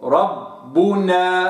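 ربنا slow with held doubled ب; slow chanted ربنا; doubled baa. Shown as a demonstration. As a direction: press the ب in ربنا. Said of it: "هكذا".

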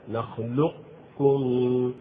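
"نخلقكم" drawn out very slowly with a hint of qaf; partial assimilation demo. Shown as a demonstration. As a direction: stretch "نخلقكم" and keep the qaf's tongue-back quality. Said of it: "هكذا".

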